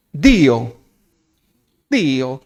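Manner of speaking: Dio said with an Italian lilt. Shown as a demonstration. Preacher trying an Italian-sounding Dio for the congregation. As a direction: give Dio a touch of Italian. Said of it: "Suena más italiano".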